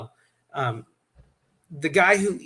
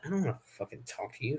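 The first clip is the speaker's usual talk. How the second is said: fast, much softer mutter; muttered under breath; 2 semitones lower, 11 dB quieter, much faster than usual. Retold or imitated. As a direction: grumble it quickly under your breath. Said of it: "the devil got to me 'cause I'm like".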